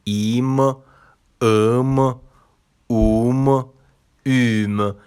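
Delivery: ایم ام اوم اوم said slowly and clearly one by one, each with a pause; teacher models the Turkish endings ایم ام اوم اوم carefully; Turkish suffixes spelled out. Shown as a demonstration. Teacher to students, models each Turkish suffix slowly. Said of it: "پسوندهای فاعلی برای شخص بن رو".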